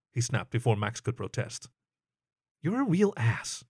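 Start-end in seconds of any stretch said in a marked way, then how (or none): 2.6-3.6 slow, higher, exasperated jab; a mild scoff at a friend; lightly voiced horse snort; snorted insult. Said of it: "the horse snorted".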